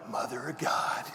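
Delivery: hushed whisper of awe; whispered aside. Retold or imitated. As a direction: breathe it under your breath. Quoting the Super Troopers cop.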